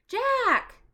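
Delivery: high, loud call. Acted. Annie calls Jack from behind a tree, high and loud. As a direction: call his name across the trees.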